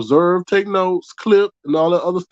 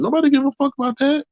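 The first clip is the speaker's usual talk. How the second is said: 1 heated outburst; 10 semitones higher, faster than usual. Real, not acted. Exasperated dismissal.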